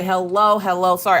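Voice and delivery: ordinary talk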